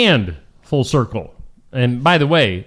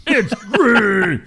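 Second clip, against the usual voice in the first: high, drawn-out arena shout; announcer voice; 4 semitones higher, much slower than usual. Acted. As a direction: stretch it like a tractor pull announcer. Mock announcing a green tractor.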